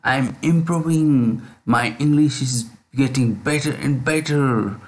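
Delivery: drawn-out, deliberate affirmations for repeating; slow affirmation drill; slow model affirmations. Shown as a demonstration. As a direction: stretch each key word for students to copy. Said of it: "This way".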